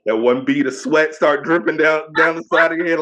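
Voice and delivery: normal talking voice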